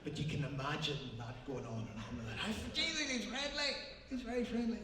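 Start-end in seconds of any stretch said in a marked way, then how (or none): 2.7-4.8 mock gushing. Acts out the endless exchange.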